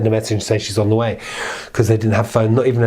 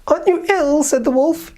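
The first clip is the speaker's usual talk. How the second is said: the wolf faking sweetness. Acted. Wolf asks grandmother.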